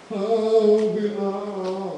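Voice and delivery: put-on haunt the children with stretched vowels; mock ghost howl; spooky ghost. Mother acts ghost through sari.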